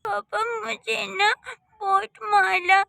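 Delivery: put-on high, tearful child's wail; weepy kid telling on mom; crying complaint. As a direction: sob it out to daddy.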